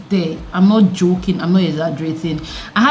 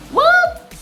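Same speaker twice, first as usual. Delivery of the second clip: very high squealed what; shocked what. Real, not acted. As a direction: squeal it in disbelief. Disbelief at her own emotions.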